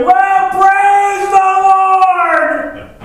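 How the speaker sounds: high-pitched drawn-out holler of praise; excited church matriarch. Retold or imitated. Old church aunt moved by a blessing, mock ecstatic holler. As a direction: wail it out slowly, way up high, hammed-up. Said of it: "She'd stand up and say".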